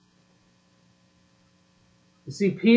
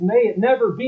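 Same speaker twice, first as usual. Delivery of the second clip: normal talking voice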